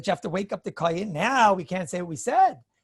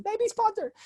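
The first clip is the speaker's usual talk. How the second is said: high-pitched concession; 14 semitones higher than usual. Real, not acted. Answering an objection that he may be exempt, high-pitched concession. Concedes the same point again.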